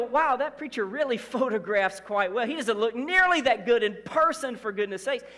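loud teasing wow, pitch up; a hammed-up gasp at a flattering photo; loud playful surprise at the preacher's picture; mock amazed exclaim. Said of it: "And you're gonna go".

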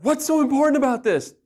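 loud, high exasperated demand; wrong, confrontational way. Shown as a demonstration. Husband showing how not to ask his wife, exasperated. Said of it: "It's not".

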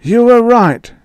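put-on high, loud old man's voice; a worn-out old inventor conceding; frail old man Ewing. A narrator's reading. Ewing conceding to Joey in a high old man's voice.

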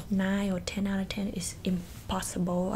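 normal talking voice

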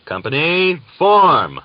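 loud, high parade-ground command; commander's shout. A narrator's reading. Commander shouting orders to his Cossack company. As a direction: bark the order across the field. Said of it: "the commander shouted".

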